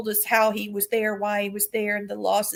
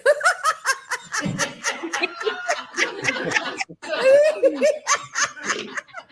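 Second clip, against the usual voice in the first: high cackling witch laugh. Real, not acted. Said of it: "I sound like a cackling witch".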